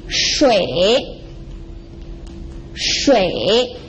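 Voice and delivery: shui drawn out, dipping then rising; falling-rising tone. Shown as a demonstration. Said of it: "a falling and rising tone".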